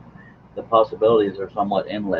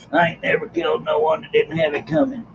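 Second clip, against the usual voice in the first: western gunslinger quote; 6 semitones higher than usual. Retold or imitated. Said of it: "That's a western reference".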